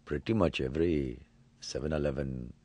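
a light Indian accent; Apu voice. Shown as a demonstration. Actor explains Apu while slipping into a light Indian accent. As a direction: ease into Apu's Indian accent. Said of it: "how did you come up with the voice of Apu".

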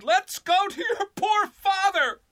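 high, loud panicked scream; frantic scream. A narrator's reading. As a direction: shriek it out to wake the house. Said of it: "She therefore screamed".

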